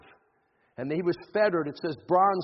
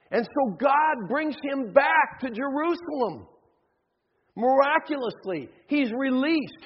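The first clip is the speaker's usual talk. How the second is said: ordinary talk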